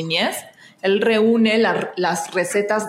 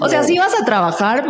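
high, louder, laughing as she quotes him; 7 semitones higher, 4 dB louder than usual. Retold or imitated. Actress laughing while quoting the agent's joke. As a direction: retell his line through laughter. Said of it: "me dice".

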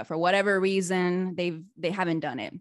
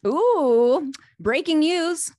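excited breaking news; 9 semitones higher, 8 dB louder, much slower than usual. Real, not acted. Host reacts to product announcement.

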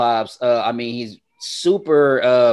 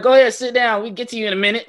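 higher, louder brush-off of an imitated waiter; mock dismissive server; 11 semitones higher, 3 dB louder, faster than usual. Host mocking Atlanta customer service, dismissive waiter voice. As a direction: wave the customer off, hammed-up careless service. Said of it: "they be like".

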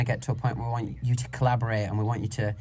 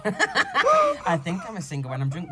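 laughing; 5 semitones higher, slower than usual. Retold or imitated. Retold reply laughing.

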